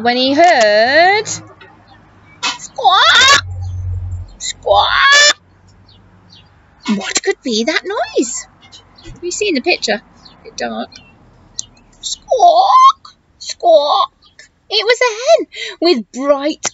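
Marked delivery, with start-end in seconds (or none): 2.6-5.4 high squawking hen call. Acted. Hen noise imitated. 12.0-14.1 mock hen squawks. Hen noise imitated.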